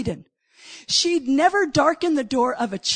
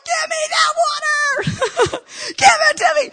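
much higher, pleading with a laugh in it; eager plea; 13 semitones higher, 4 dB louder than usual. Retold or imitated. Samaritan woman begging Jesus for living water, high and eager. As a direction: beg for it like she can't wait.

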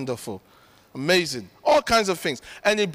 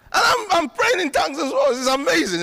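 overcome; 8 semitones higher than usual. Real, not acted. Overcome while praying in tongues.

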